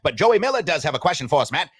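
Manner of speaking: a hint of the old radio man; 1920s sportscaster voice. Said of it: "nineteen twenty sportscaster's voice".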